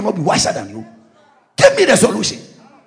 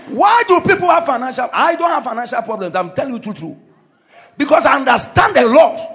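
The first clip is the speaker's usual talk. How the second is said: normal talking voice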